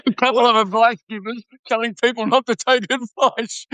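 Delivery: amused words riding on a real laugh. Real, not acted. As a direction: crack up while saying it. Both hosts laughing at the irony.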